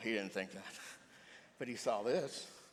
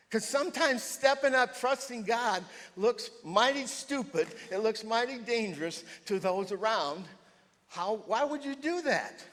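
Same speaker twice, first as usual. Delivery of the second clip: higher and louder, slow and forceful; full-force preaching; 10 semitones higher, 9 dB louder, slower than usual. Acted. Preacher to the congregation about trusting God, loud and slow. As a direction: proclaim it to the back row.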